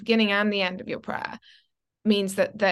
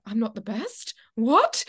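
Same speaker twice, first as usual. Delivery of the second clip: mock disbelief; 2 semitones higher, slower than usual. Drama student stunned to learn she's not best, disbelieving. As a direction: act out the shock of not being top. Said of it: "I was like".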